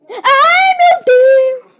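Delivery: sudden scared shriek, high and loud; frightened scream. Real, not acted. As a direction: cry out in real fright. Monster spawned in face.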